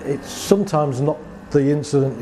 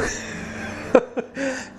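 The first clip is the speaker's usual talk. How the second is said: soft airy chuckle; quiet breathy laugh; 10 semitones higher, 6 dB quieter than usual. Real, not acted. Laughing at own story.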